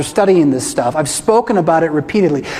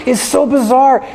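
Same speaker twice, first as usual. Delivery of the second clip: raised, incredulous; a touch higher, amazed; excited disbelief; 5 semitones higher than usual. Real, not acted. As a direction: marvel aloud at how strange it is. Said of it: "Our religion's insane".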